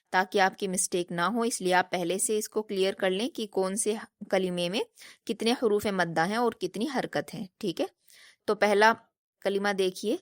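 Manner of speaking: ordinary talk, nothing marked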